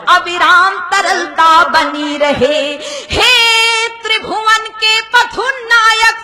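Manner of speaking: measured, chant-like invocation in verse; poem recitation. Acted. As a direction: recite a prayer poem with sustained, even tones.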